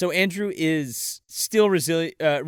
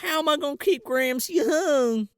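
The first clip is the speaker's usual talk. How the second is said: higher-pitched hammed-up weepy wail; mock crying; 9 semitones higher than usual. Girl who lost the berries wonders aloud, mock-tearful wail. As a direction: lament it as if crying a river. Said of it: "she cries a river".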